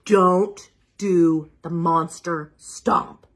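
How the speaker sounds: ordinary delivery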